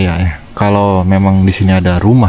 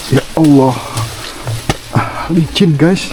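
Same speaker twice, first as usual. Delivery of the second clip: startled by slipping; 5 semitones higher, slower than usual. Real, not acted. Slipping on muddy path.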